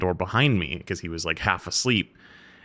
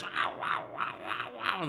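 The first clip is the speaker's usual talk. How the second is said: dog bark imitation; 9 semitones higher, 9 dB quieter than usual.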